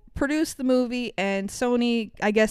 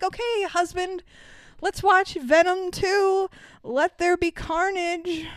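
slow, higher, grand proclamation of a fair god; mock divine decree; 8 semitones higher than usual. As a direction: hand down the verdict like a deity. Grants husband's wish like a god.

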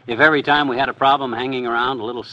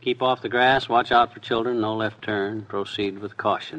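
softer mumbled list of signs, trailing lower and slower; mumbling; 5 semitones lower, 5 dB quieter than usual. Acted. Husband counting street signs to himself, mumbling. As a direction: mutter the signs under your breath, fading away. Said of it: "as Fibber mumbles like this".

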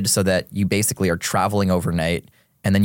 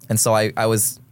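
ordinary talk

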